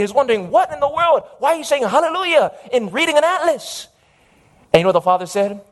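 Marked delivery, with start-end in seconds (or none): 0.5-3.9 high-pitched, baffled complaint; acted-out irritated disbelief; a little irritated. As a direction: question dad in mild exasperation. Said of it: "a little bit irritated".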